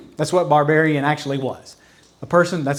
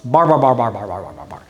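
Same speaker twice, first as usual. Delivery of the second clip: bar bar chant sinking lower and fading; mocking babble; 6 semitones lower, faster than usual. Pastor showing congregation the word's sound, fading mock babble. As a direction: let the babble drop away lower and lower.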